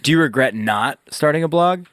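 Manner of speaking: usual speaking voice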